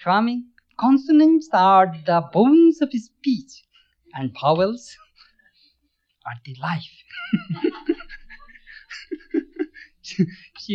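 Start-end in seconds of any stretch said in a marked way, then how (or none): 0.0-7.0 a fond impression of an old English teacher; imitating his Scottish teacher. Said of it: "my English teacher, that Scottish lady, used to say". 7.1-8.2 a short high laugh; fond laugh. Real, not acted. Laughs after quoting his teacher.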